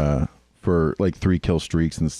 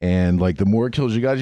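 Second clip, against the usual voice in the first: ordinary talk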